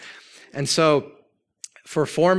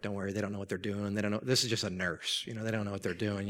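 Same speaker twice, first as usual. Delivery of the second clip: much softer, quick low murmur; hushed reassurance; 5 semitones lower, 10 dB quieter, faster than usual. Retold or imitated. Husband quietly, quickly reassures his frightened wife. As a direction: hurry comfort under your breath.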